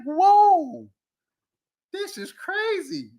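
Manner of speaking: mock shock. Ranting to viewers, mock stunned high voice. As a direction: act floored by the news. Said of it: "I'll be like".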